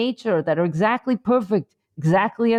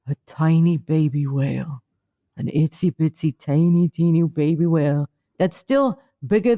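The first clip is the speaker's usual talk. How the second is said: lightly hushed, lower guided-meditation voice; mock mindfulness; 4 semitones lower than usual. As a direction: parody a calm wellness guru, only a little. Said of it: "my mindfulness moment".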